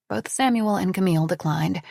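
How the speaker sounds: usual speaking voice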